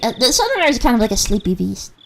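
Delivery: ordinary talk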